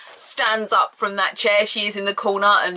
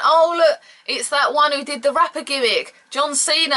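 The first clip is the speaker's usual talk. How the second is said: mock recognition; 7 semitones higher than usual. Crowd mocking Cena's old gimmick.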